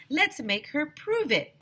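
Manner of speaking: put-on high, slow, determined kid; a kid insisting with glee; lightly voiced excited child; eager kid. Kid urging.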